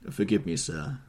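ordinary delivery, nothing marked

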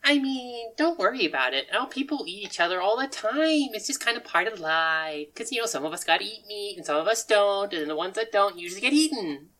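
cheerful odd character. Acted. Game master as Sushi Kid reassuring players, cheerfully odd.

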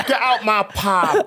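mock EastEnders. Parodying EastEnders pub landlord.

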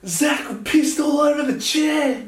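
put-on very high, loud, drawn-out kid's yell; mock tattling shout. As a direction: imitate a schoolboy telling on a classmate to the whole room. Tattling schoolboy announces accident to teacher.

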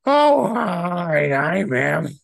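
put-on high, breathy girly voice.

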